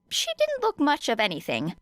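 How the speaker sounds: ordinary delivery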